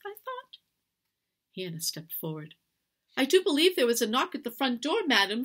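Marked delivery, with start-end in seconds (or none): 3.2-5.4 polite interruption. A narrator's reading. Hannah politely interrupts the rage.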